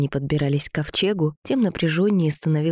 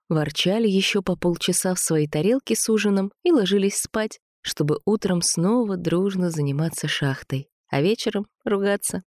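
ordinary delivery

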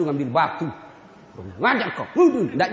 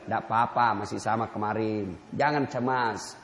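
low, gentle, unhurried voice of the Prophet; calm Prophet; 12 semitones lower, 5 dB quieter than usual. Acted. The Prophet gently answers the man who spat, low and calm. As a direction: smile through the words, easy and forgiving. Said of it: "Nabi tersenyum".

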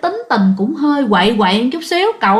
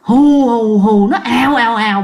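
imitated gusting wind; 4 dB louder than usual. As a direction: mimic strong wind in repeated gusts. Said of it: "tiếng gió nó hù".

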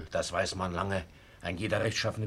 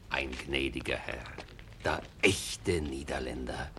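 slow whispered praise; admiring whisper; 5 semitones lower, 5 dB louder, slower than usual. Acted. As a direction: breathe it out slowly in awe. Admiring remark after Egmont leaves. (langsam geflüstert, bewundernd)

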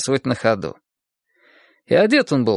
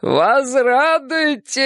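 put-on slow higher drunken proclamation; slurred drunk speech; 12 semitones higher, much slower than usual. A narrator's reading. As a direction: stretch it out, blissfully drunk. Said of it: "повторил он". (пьяный возглас)

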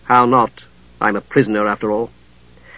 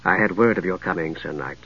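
low, cultured woman's voice; 7 semitones lower, 9 dB quieter than usual. A narrator's reading. Catherine greets the knight.

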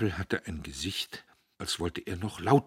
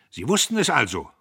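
loud, higher accusing question; sharp question; 8 semitones higher, 12 dB louder than usual. Acted. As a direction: pin him down, cold and loud. Diederich stellt den Vater. (scharfe, laute Frage)

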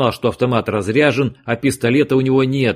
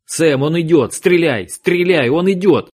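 higher, louder frantic yell; urgent shout; 7 semitones higher, 4 dB louder, slower than usual. Acted. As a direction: scream the warning to your partner. Agent yelling warning in gunfight.

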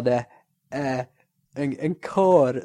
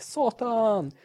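retold curse yell; 9 semitones higher than usual. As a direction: yelp the curse as the panicking driver. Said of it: "jag bara skrek". (ett påhittat förskräckt utrop)

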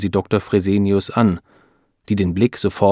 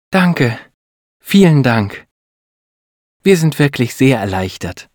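higher, louder thanks, full of relief; delighted; 5 semitones higher, 6 dB louder than usual. A narrator's reading. Wife thanks the doctor for good news, delighted. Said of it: "sagt Beate erfreut".

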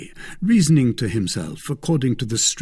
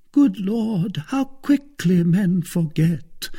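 mild lament; 10 semitones higher, 4 dB louder, slower than usual. A narrator's reading. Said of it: "cried the procurator's wife".

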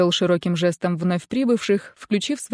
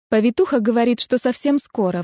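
usual speaking voice

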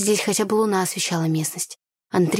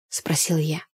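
ordinary delivery

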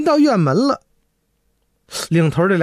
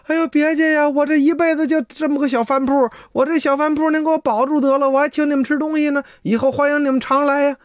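put-on higher, begging voice of a frightened old innkeeper; pleading old man; 8 semitones higher than usual. Old innkeeper begging the loach spirit to spare his inn, high pleading. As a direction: beg them to spare the little shop.

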